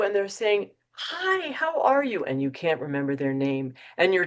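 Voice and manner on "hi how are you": eager greeting. Acted. Acted rushing acquaintance greeting.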